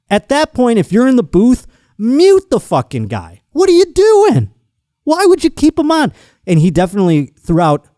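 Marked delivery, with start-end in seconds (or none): 0.1-6.1 high, incredulous outburst; exasperated rant. Real, not acted. Host berating the booth for not muting, exasperated. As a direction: scold them in disbelief, voice climbing high and loud.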